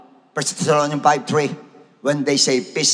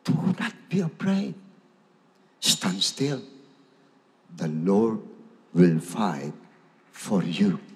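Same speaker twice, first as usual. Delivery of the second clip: a hint of a calm, soft Moses, lower and softer; calm reassurance; 7 semitones lower, 5 dB quieter, slower than usual. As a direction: quietly settle a panicking crowd. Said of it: "Ito, sagot niya".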